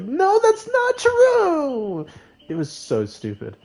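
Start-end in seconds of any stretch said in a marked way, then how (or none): 0.0-2.1 very high, slow, stretched-out shrieking denial; overreacting shriek. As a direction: overreact with a long squealing no. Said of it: "I was like".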